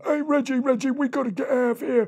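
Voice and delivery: high, quick, stammering crab voice; frantic squeaky little crab; land crab Wilfred. Acted. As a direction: panic and urge a pal to flee. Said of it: "And the land crabs went".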